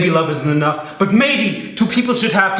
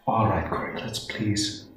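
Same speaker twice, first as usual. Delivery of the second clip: soft tired plea; 7 semitones lower, 10 dB quieter than usual. Acted.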